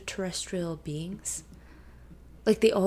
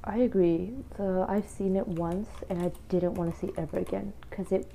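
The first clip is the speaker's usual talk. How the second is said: talking into a cup; muffled. Real, not acted. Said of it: "my voice will sound very muffled".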